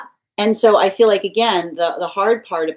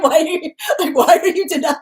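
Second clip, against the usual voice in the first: much higher, louder mock outrage cracking into laughter; an offended guide squeaking through giggles; indignant guide; 12 semitones higher, 7 dB louder than usual. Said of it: "And the guide being like".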